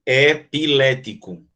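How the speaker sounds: "Epilético" spelt out slowly, syllable by syllable, E apart; "Epilético" said slowly with each syllable separated. Shown as a demonstration. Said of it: "O E é sozinho".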